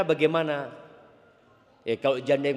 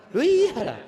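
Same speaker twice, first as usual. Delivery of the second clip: mock exasperation; 8 semitones higher, 6 dB louder, slower than usual. Real, not acted.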